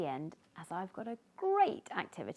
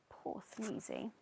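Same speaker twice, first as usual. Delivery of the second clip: much softer, pitying; sympathetic; 3 semitones higher, 8 dB quieter than usual. A narrator's reading. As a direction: say it with pity. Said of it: "pity for Sneezy".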